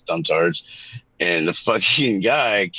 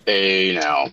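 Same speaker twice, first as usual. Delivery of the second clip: ordinary talk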